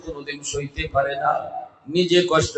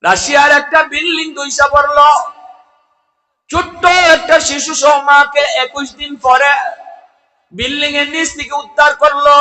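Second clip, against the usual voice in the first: high, loud sermon cry; full-force preaching; 11 semitones higher, 9 dB louder than usual. Real, not acted. (উচ্চস্বরে জোরালো ওয়াজ)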